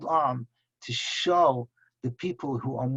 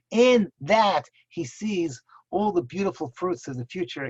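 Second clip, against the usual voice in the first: rising preaching; 4 semitones higher, 4 dB louder than usual. Real, not acted. Rabbi to listeners on Tisha B'Av, rising fervent preaching. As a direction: lift the congregation toward the vision.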